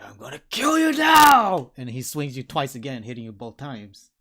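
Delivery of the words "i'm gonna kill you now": high, full-force shout; furious in-character battle yell; threatening yell. Acted. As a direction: roar it as an attacking enemy. Enemy's threat acted by game master.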